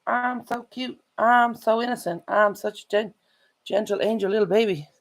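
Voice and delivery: high cutesy innocent puppy voice; voicing the dog. Voicing her dog claiming he is innocent, high cutesy. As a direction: play the pup pleading innocence, high and sweet. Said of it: "look at him. He's like".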